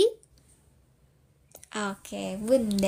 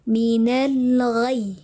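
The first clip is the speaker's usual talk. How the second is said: "Minal ghai" drawn out very slowly, pressed on "ghai"; nabar demo; much slower than usual. Shown as a demonstration. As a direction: recite "Minal ghai" slowly, leaning on the final "ghai" without breaking it. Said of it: "Dinaikkan intonasinya". ("Minal ghai" dengan nabar, pelan dan ditekan di "ghai")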